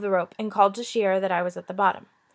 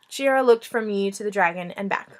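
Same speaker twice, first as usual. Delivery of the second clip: ordinary delivery, nothing marked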